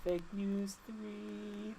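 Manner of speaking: high, soft, drawn-out segment sting; mock jingle. Co-host labels the third story, high slow mock jingle.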